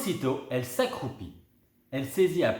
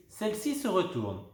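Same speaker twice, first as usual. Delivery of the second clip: usual speaking voice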